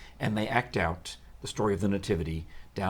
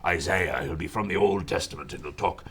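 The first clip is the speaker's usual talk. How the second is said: deep, self-important prophet demo; Old Testament Isaiah; 3 semitones lower than usual. Shown as a demonstration. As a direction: talk very importantly, like a biblical prophet. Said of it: "give him more of an Old Testament sound".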